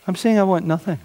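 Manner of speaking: normal talking voice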